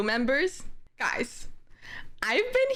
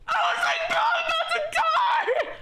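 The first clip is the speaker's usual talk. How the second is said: much higher panicked cry; scared shriek. Real, not acted. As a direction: squeal it in terror. Scared on noisy plane.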